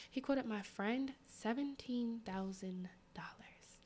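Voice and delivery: slow confiding whisper. Real, not acted. Whispering the price to her viewers.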